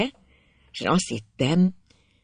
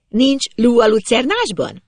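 high, raised teasing question; girl calling up; 8 semitones higher, 4 dB louder than usual. A narrator's reading. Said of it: "Felkiáltott".

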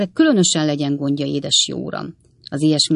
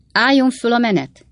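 raised, higher-pitched storybook shout; called-out order; 7 semitones higher, 4 dB louder than usual. A narrator's reading. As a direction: call the procession to order, moderately loud. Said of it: "kiáltotta a húsz bizottsági tag". (mérsékelt, emelt hangú kiáltás)

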